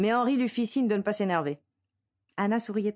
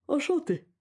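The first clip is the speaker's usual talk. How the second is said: yawning; 10 semitones higher than usual. A narrator's reading. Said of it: "dit le barman en bâillant".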